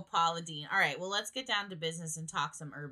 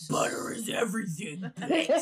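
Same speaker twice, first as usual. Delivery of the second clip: whispered then a much higher, louder snap on bitch; Paula Deen meme voice; 5 semitones higher, 6 dB louder than usual. Retold or imitated. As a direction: hiss it, then spit the last word. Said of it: "her eyes are fire".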